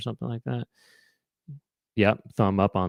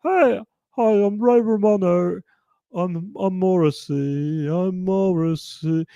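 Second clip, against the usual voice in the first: much higher, slow, mock British crooner voice; 8 semitones higher, slower than usual. Streamer joking to chat, a hammed-up high British croon. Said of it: "[name] if he was, kind of, British".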